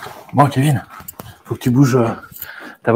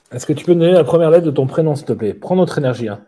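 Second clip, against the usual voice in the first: séance medium; 3 semitones higher than usual. Acted. Asks the spirit for a letter.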